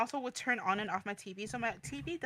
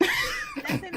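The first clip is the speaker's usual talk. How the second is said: high; 7 dB louder than usual. Real, not acted. Laughing defense of pun.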